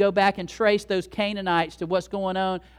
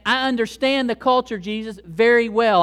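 raised, emphatic voice; acted insistence; 4 semitones higher, 8 dB louder than usual. Gentile woman answering Jesus about the culture, raised insistent voice. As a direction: insist firmly, higher and louder.

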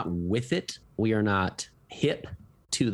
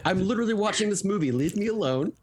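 mock annoyed teen; 6 semitones higher than usual. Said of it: "I thought you were".

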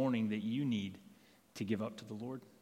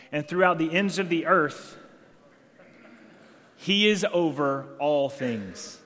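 louder, slowing hard on he is over all things; climactic proclamation; 4 semitones higher, 7 dB louder, slower than usual. Real, not acted. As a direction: build and land each word with weight. Sermon climax, proclaiming God.

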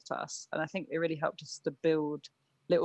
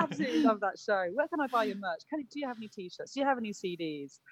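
higher, quick Irish fan voice; Irish accent; 6 semitones higher, faster than usual. Retold or imitated. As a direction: gush like an Irish fan at the merch table. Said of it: "That's why I can't do Irish accent".

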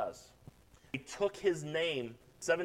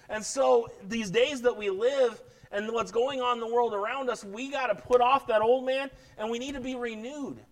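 urgent preacher, pitched up; fired-up preaching; 7 semitones higher, 5 dB louder than usual. Real, not acted. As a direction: exhort the congregation with raised, forceful energy. Preacher urging the congregation.

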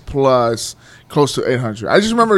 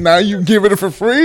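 higher, louder indignant question; mock outrage; 8 semitones higher, 6 dB louder than usual. Real, not acted. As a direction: pretend to be wronged.

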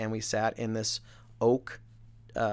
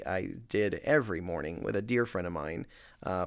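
ordinary delivery

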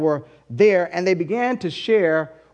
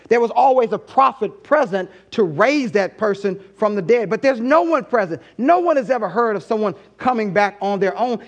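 ordinary talk, nothing marked

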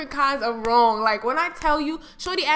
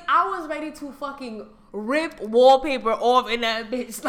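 ordinary talk

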